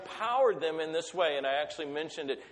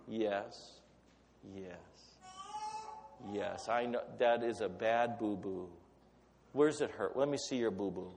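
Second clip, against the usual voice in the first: put-on soft, low, gentle hush to a hurt child; tender comforting father; 4 semitones lower, 6 dB quieter than usual. Heavenly Father comforting his crying child, soft and tender. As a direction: soothe a little one's boo-boo. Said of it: "God of all the universe will lean over".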